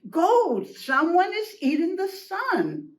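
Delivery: high-pitched insistent call; urgent alarm. Acted. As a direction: press the news on Goat.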